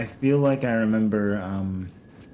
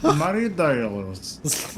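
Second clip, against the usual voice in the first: put-on very drawn-out, garbled Swedish accent, pitched up; exaggerated, stretched Swedish accent, throaty and garbled; mock Swedish accent; 4 semitones higher, much slower than usual. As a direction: imitate a Swedish accent with something stuck in the throat. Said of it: "like he had something in his throat".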